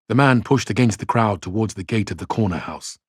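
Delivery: ordinary delivery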